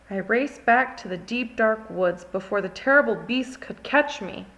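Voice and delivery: ordinary delivery, nothing marked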